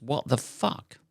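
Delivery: ordinary talk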